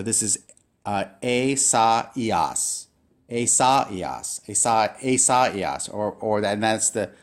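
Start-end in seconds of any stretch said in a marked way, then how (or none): 1.2-2.9 "e, sa, ias" as ay-SAH-ee-ahs, falling at the end; vowels pronounced separately. Shown as a demonstration. Teacher explains the two dots, vowels said separately. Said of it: "you have to pronounce the vowels separately". 3.3-5.9 "E, sa, ias" repeated as ay-SAH-ee-ahs; vowels pronounced separately. Shown as a demonstration. Teacher repeats the Greek name Isaiah, separated vowels. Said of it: "pronounce the vowels separately".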